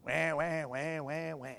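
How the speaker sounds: soft, low mocking whine; droning pretend baby cry; mock wailing "wah wah". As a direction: imitate a crybaby complaining. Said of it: "Complaining about this".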